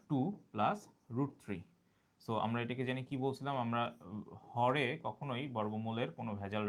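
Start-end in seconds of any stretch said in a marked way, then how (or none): 0.1-1.6 two plus root three drawn out very slowly; slow dictation. Shown as a demonstration. Teacher dictating the answer slowly to students. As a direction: write it as you say it.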